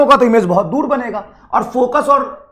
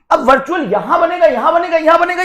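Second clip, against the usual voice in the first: mock parrot recitation, pitched higher; mocking rote student; 6 semitones higher than usual. Teacher mocking how a student recites by rote, mock sing-song. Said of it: "ये रटेगा".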